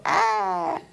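short high yelp of disgust; a sudden high squeal at the dead frog; shriek of disgust. Retold or imitated. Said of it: "I wanted to go".

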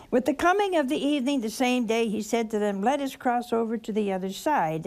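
fast, careless read-through of scripture; rushed newspaper reading. Shown as a demonstration. Preacher showing the congregation a hurried reading. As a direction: rattle it off like skimming a newspaper. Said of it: "if you read it like a newspaper".

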